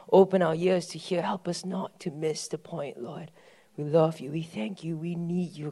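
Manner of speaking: hushed, slow prayer falling away to a whisper. Acted. Pastor praying over the congregation, hushed and fading.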